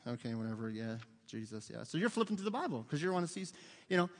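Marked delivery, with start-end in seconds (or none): 0.1-1.8 low, soft, dismissive mumble; tuned-out, under-the-breath agreement; uninterested teenager. Retold or imitated. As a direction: imitate a bored teen half-listening in youth group. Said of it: "when the guy's teaching, you're like".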